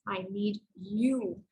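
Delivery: slow, with "you" drawn out at the end; stress on YOU. Shown as a demonstration. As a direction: save the weight for a long "you". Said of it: "stress on the third word in the sentence".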